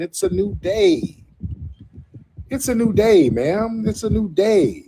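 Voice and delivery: high, drawn-out singsong taunt; gloating singsong. Real, not acted. Host taunting those upset about boundaries, gloating singsong. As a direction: crow it in a high lilting chant.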